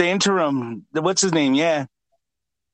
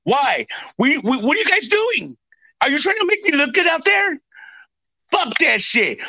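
high, yelling coach tearing into his players; furious coach rant; 8 semitones higher than usual. Retold or imitated. Furious coach yelling at his players at halftime. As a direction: scream at the team like a mad coach.

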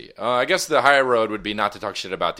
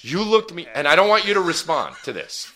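indignant scolding; 5 semitones higher, 4 dB louder than usual. Real, not acted. [name] scolding Brian.

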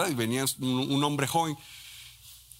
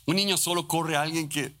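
normal talking voice

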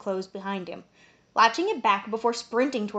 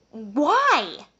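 horrified; 4 semitones higher, 5 dB louder than usual. Acted. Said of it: "I was horrified".

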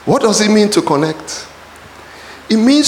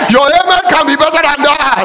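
preacher shouting for a bigger amen; shouted demand; 7 semitones higher than usual. Real, not acted.

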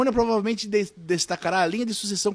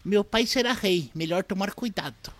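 bratty prince voice; 3 semitones higher than usual. Retold or imitated. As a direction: play the smug little prince. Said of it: "o príncipe George afirma".

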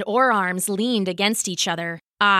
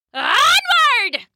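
high, loud rallying yell; yelled charge; 6 dB louder than usual. Acted. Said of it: "she yelled".

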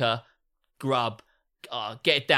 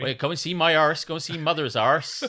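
loud, higher, broad West Country; West Country accent; 5 semitones higher, 6 dB louder than usual. Acted. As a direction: do a hammed-up West Country yokel, rolling the r. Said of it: "that was West Country".

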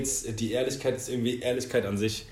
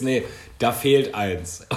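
slow blunt truth; much slower than usual. Pretend. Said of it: "Wahrheit ist".

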